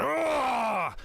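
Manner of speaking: sudden high pained cry; pained giant roar. Acted. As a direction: yelp as the blade goes through. Giant pierced through the leg.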